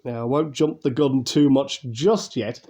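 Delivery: ordinary delivery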